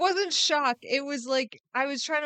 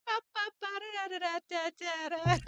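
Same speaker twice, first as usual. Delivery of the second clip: high babbling nonsense syllables; buzzing babble; 11 semitones higher, 3 dB quieter, much faster than usual. Retold or imitated. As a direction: babble like background noise he tunes out. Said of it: "all he hears is".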